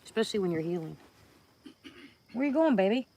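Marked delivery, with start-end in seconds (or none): 2.3-3.1 high, loud coaxing call to the pet; pet talk. Real, not acted. Calling after her cat as it wanders off, high sing-song.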